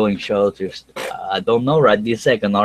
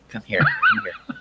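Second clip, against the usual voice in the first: squeaky-high, slow mock coaxing; playful beckoning; 3 dB quieter, slower than usual. Host calling his thumbs downers over, playful beckoning. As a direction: coax them over in a tiny falsetto, laughing.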